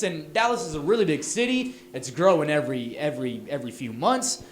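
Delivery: usual speaking voice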